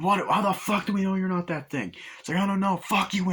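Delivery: two paranoid men snapping at each other; mock angry argument. Paranoid crewmen argue about who is the thing, high angry shouting.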